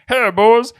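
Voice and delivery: high, loud put-on hail; hammed-up homeless man imitation; imagined homeless man. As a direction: call to the boys as a strange street guy. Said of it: "I imagine that's what he sounded like".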